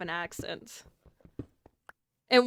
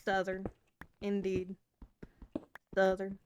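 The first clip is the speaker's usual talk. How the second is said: southern twang; 3 semitones lower, 8 dB quieter than usual. Shown as a demonstration. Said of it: "put that southern twang to it".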